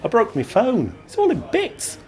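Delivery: put-on high, comic whining drunk woman; tipsy woman, whiny. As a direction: wail about the broken phone. Drunk woman complains of phone.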